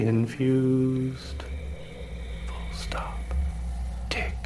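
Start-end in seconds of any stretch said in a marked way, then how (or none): 2.5-4.3 punctuation breathed in a whisper; whispered dictation. Acted. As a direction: whisper the punctuation marks aside. Reading punctuation aloud.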